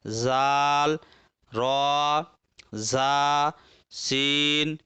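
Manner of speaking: slow, evenly chanted letter names, open "aa" endings as in बा and ता; maruf Arabic letter names. Shown as a demonstration. Teacher models the Arabic letters for students, maruf, slowly drawn out. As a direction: recite the alphabet with drawn-out pure long vowels, बा, ता, सा, जीम. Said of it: "अरबी हरूफ को हम लोग मारूफ पढ़ेंगे".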